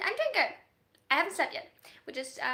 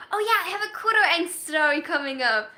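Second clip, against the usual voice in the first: ordinary talk, nothing marked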